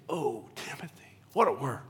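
hushed, breathy whisper of farewell. Acted. Preacher voicing Paul's farewell to Timothy, whispered. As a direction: whisper it like a last goodbye.

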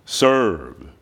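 low, weighty divine command; deep-pitched solemn order; voice from above. Acted. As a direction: intone it low like a voice from heaven.

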